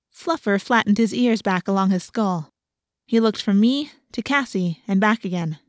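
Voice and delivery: usual speaking voice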